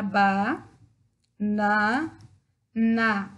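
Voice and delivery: "banana" broken into "ba", "na", "na" with pauses; syllable by syllable. Shown as a demonstration. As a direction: split "banana" into three clapped syllables. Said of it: "vamos lá". (separando "banana" em sílabas com pausas)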